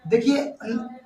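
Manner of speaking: ordinary talk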